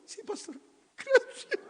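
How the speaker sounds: high, weepy little reply; hammed-up crying thanks; mock tearful. Sister thanking her pastor, high mock-tearful voice.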